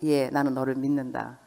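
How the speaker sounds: low, soft, calm words of trust; quiet trust. Shown as a demonstration. Parent showing the right way to talk to a child, soft and low. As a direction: tell the child gently you believe in them. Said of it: "이거 무서운 말인 거예요".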